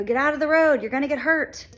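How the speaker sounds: loud, high panicked yell; retold scream. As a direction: shout a child out of the road in panic. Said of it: "you're screaming".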